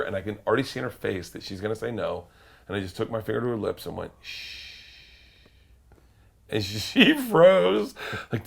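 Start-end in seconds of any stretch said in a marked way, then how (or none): 4.2-4.6 long, quiet hissed "shh"; soft shush. Retold or imitated. Drunk passenger, finger on attendant's lips, soft shush. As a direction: hush her gently mid-refusal.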